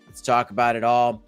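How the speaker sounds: ordinary talk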